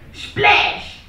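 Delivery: high breathless splash noise; splash sound. Acted. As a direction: spit out a splash. Spaghetti hits mum.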